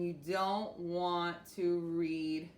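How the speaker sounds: slow, dragged-out mock reading; dragging demo. Shown as a demonstration. Teacher showing students how not to read, too slowly. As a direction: drag the words too slowly on purpose. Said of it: "sound the way that you speak".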